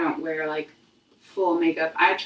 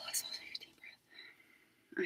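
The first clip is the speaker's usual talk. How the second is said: slow, very soft whisper; breathy whisper; 21 dB quieter, much slower than usual. Real, not acted. Vlogger inviting viewers to breathe, slow calming whisper. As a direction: whisper it slowly on an outgoing breath.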